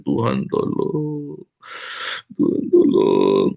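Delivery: mock moaning plea. Shown as a demonstration. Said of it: "Bukan merintih".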